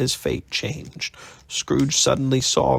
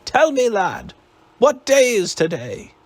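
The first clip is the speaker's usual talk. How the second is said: called down; 10 semitones higher than usual. A narrator's reading. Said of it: "Scrooge called down".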